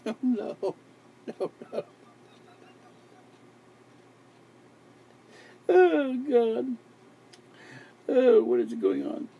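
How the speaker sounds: high, loud helpless giggly exclamations; giddy disbelief. Real, not acted. Exhausted host cracking up at his own word, giddy. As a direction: crack up at yourself.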